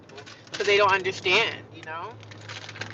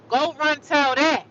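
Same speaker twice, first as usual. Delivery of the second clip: slow, louder, higher taunt; taunting catchphrase; 6 semitones higher, 4 dB louder, slower than usual. Acted. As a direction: dare them to snitch.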